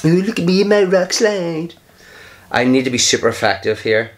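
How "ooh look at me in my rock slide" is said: mock smug opponent. Mocking opponent's move.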